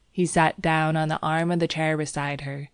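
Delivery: ordinary delivery, nothing marked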